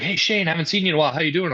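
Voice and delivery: high, fast imitated hello; cheery greeting. Friend spotting Shane outside the arena, high fast greeting. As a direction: toss off a quick friendly hey. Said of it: "he's like".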